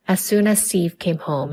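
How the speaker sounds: ordinary delivery, nothing marked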